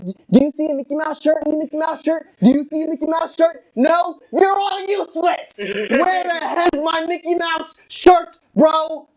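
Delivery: high-pitched, frantic asking around. Retold or imitated. Asking everyone around for the lost shirt, frantic. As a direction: build from urgent questions to a shouted demand.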